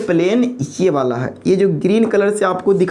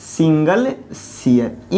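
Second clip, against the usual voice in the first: slow repeat; 3 semitones lower, much slower than usual. Shown as a demonstration. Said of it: "क्या बोलते हैं?".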